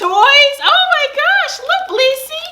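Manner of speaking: very high, loud, slow gush; excited pet talk. Real, not acted. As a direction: squeal it to the dog. Showing toys to the dog.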